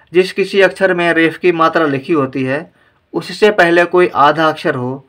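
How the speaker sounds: ordinary talk, nothing marked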